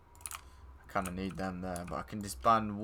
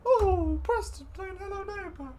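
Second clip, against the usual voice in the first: much higher, sing-song teasing squeal; mocking falsetto; 6 dB louder than usual. Gamer teasing friend Preston about his game, high mocking falsetto. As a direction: squeak it out to wind up your friend.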